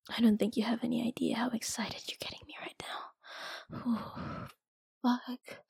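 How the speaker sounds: soft breathy whisper, voiceless. Acted. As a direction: whisper it close to the ear. Intimate whisper.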